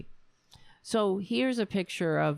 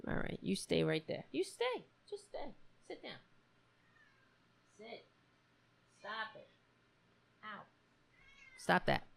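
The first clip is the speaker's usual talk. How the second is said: gentle pet commands; 5 semitones higher, 10 dB quieter than usual. Real, not acted.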